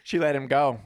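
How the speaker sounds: plain reading voice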